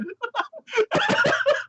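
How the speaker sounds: high, helpless laughing fit; high giggling laugh; real laughter. Real, not acted. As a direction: crack up at your own story. Laughing at own retelling.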